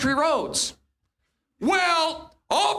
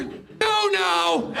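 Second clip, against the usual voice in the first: emphatic comic denial; 4 semitones higher than usual. Acted. Comic answer to speed guess.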